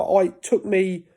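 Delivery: normal talking voice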